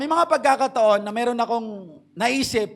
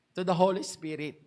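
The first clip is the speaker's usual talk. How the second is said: ordinary talk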